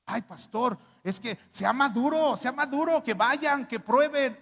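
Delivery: preacher mocks a member begging to try other churches, high and whiny; mocking churchgoer. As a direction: whine it like a pushy member. Mocking a member who wants courses.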